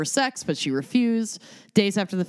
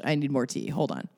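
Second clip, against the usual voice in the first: ordinary talk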